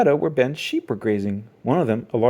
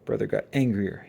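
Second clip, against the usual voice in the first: ordinary delivery, nothing marked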